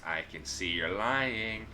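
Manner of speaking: slow teasing sing-song. Acted. Wenduag taunting the lying trader, slow sing-song. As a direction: drag it out like a taunting nursery tune. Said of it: "she says in a sing-song voice".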